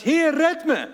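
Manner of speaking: high, loud cry for help; cried out. Acted. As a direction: shout it as a desperate plea. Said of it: "schreeuwde het uit".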